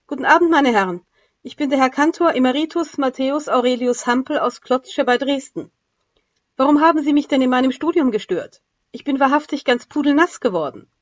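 a pompous little cantor complaining he is soaked; fussy old cantor. Acted. Soaked captured cantor addresses chiefs.

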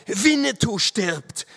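high, loud, drawn-out cry; anguished shout. A narrator's reading. Narrator to Walker as his friend falls, high anguished shout. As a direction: shout the terrible news across, slowly. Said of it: "rief ich ihm zu".

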